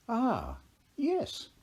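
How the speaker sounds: high, sly Irish leprechaun king. A narrator's reading. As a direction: grin through it like a cunning leprechaun. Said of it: "said the king".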